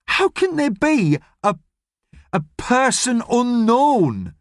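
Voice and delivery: high, pleading disbelief, slowing on person unknown; desperate girl grasping at the words. Acted. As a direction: Ellie pleading with the policeman, high and slow. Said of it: "says Ellie desperately".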